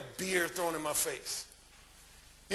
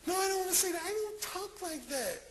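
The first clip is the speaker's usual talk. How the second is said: mock whine in a much higher pitch, softening at the end; imitated woman protesting; 10 semitones higher than usual. Woman refusing his demand to talk dirty, high mock protest.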